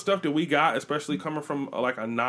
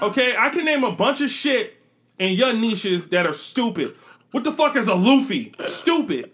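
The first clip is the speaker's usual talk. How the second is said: high, loud playful scolding; mock indignant rant; 7 semitones higher, 5 dB louder, slower than usual. Defending her niche against a laughing friend, loud mock indignation. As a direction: fire back at the teasing, half serious.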